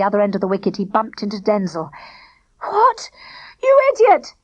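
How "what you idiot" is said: high, loud frantic shout; shrill alarmed shout at the teammate. A narrator's reading. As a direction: yell in panic at your partner. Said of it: "he shouted".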